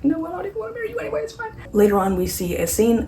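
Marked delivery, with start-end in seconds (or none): 0.0-1.5 mock crying. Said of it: "as she cries".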